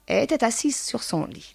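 ordinary delivery, nothing marked

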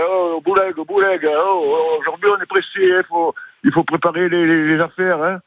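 hammed-up Marseille accent, rushing his wife; broad Provençal accent. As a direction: sing out in thick Marseille accent, urging. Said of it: "je dis".

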